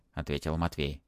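ordinary delivery, nothing marked

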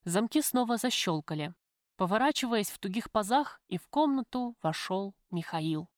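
ordinary delivery, nothing marked

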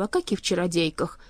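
ordinary delivery, nothing marked

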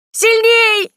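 very high, loud shriek; squeal. A narrator's reading. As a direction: squeal it out at full pitch, falling at the end. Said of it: "визжала та".

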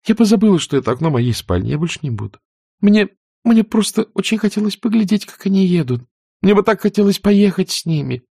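a hint of a pouting young widow; lightly voiced wistful girl. Scarlett excuses herself. (слегка капризно и мечтательно)

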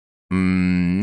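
ordinary delivery, nothing marked

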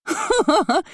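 high-pitched scornful cackle; mocking laugh. A narrator's reading. Curly-haired girl ridiculing her sister, mocking laugh. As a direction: burst into a high derisive laugh. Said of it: "громко расхохоталась".

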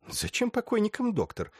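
high astonished question; surprised. Acted. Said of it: "удивилась Ростоцкая". (удивлённо)

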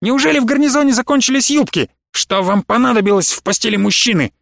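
high-pitched indignant outburst; fly off the handle, scathingly; flaring up. A narrator's reading. Professor exploding at the colonel over his bed, flaring up. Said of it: "профессор взвился".

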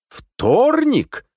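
«Вторник?» high, loud and drawn out; astonished question. A narrator's reading. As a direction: squawk «Вторник?» in disbelief. Phone news contradicts his belief. (изумлённо, протяжно: «Вторник?»)

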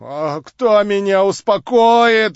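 медленно и громче, с мольбой; воскликнуть жалобно; восклицание. Acted. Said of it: "воскликнул он". (отчаянный возглас)

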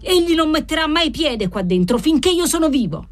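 high-pitched, vehement vow; furious refusal. A narrator's reading. Father forbidding the doctor his house, furious.

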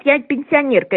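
normal talking voice

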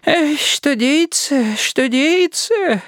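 put-on high old woman wail; bitter sighing. A narrator's reading. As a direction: sigh it out bitterly, slowly. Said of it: "горько завздыхала".

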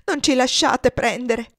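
loud, high, frantic plea; terrified begging, slowing at the end; beside herself. A narrator's reading. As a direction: beg desperately for protection. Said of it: "come fuori di sé".

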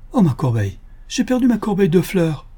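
high-pitched cry of alarm; sudden cry. A narrator's reading. Lady discovering her brooch is lost, sudden cry. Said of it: "subitement elle poussa un cri".

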